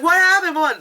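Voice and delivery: put-on high excited kid asking; squeaky child voice. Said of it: "you're like".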